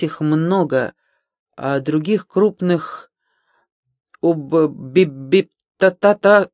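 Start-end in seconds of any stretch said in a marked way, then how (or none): 4.2-6.5 stuck on "об-, би-", repeating syllables; stammering. Acted. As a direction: stumble through "би-, та-та-та-" in broken bursts. Narrator acts stumbling over a word.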